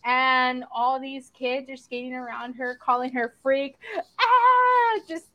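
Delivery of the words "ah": high, loud drawn-out "ah"; mimicked jeering cry. Retelling kids circling and calling her freak, mimicked jeer.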